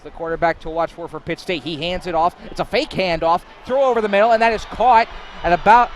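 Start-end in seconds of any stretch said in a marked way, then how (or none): 2.5-5.9 high, loud call of a completed pass; excited play-by-play. Real, not acted. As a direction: call the pass louder as it gains yards.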